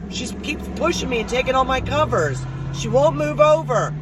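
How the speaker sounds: high, worked-up complaint; dad complaining loudly. Retold or imitated. Dad complaining of the unseen bedmate, imitated angry holler.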